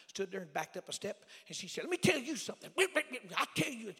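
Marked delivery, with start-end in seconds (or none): 1.8-3.9 higher, louder imitation of an irate woman; angry woman. Woman confronting her pastor on the steps, angrily imitated. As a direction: mimic her telling someone off, high and forceful. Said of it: "her anger, her tone".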